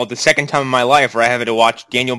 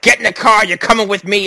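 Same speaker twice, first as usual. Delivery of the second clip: mock bossy command; 7 semitones higher than usual. Hero ordering woman at gunpoint.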